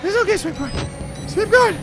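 very high cooing reassurance; high soothing coo. Real, not acted. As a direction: coo like to a pet.

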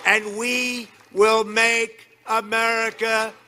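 booming campaign rally delivery; rally speech. Acted. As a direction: shout it to a packed arena.

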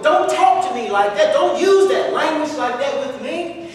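loud offended retort; acted indignation. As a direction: tell them off forcefully. Acted offended listener quoted as warning.

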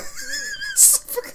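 a short high giggle; giggling. Real, not acted. High giggle at the screen. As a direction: burst into a giggle.

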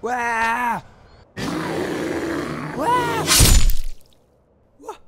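loud high battle yells; battle cry. Acted. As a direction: charge with fierce shouts. Prince fights the dragon.

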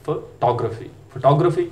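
फोटोग्राफी as fuh-TOG-ruh-fee, twice; weak first vowel, stressed rounded "tog" in फोटोग्राफी; British pronunciation. Shown as a demonstration. Teacher repeating for learners, British fuh-TOG-ruh-fee for फोटोग्राफी. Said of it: "ये British pronunciation है".

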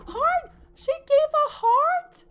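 high, loud, slow squeal of joy; thrilled shout. Real, not acted. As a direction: scream it out with delight. Baby gives a heart in game.

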